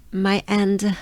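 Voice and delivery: normal talking voice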